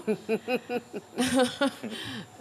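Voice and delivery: real amused laugh. Real, not acted. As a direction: laugh at your own story. Laughs at her own tale. (rire amusé)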